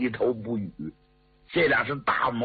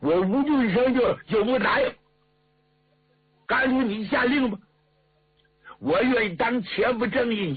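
indignant officer, pitch climbing high as he demands battle; angry thick-tongued officer; 7 semitones higher than usual. A narrator's reading. Angry officer urging the marshal to attack, indignant and high-pitched. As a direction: work up into a high-pitched, outraged plea to fight. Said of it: "说话也不清楚， 气得直晃脑袋".

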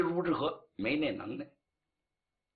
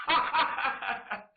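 wild laugh; 10 semitones higher than usual. A narrator's reading. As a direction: cackle triumphantly from behind him. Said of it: "听见身后有人的狂笑". (得意的狂笑)

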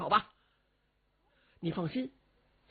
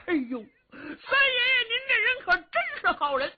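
fake sobbing; 13 semitones higher, 3 dB louder, faster than usual. Cheng keeps up his weeping act.

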